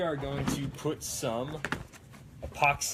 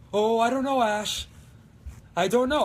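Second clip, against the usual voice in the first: high, loud, unsure sidekick; Pablo; 11 semitones higher, 9 dB louder than usual. Acted. Pablo doubting himself to Ash, high uncertain impression. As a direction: worry aloud in a raised voice. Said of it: "Pablo's very unsure of himself".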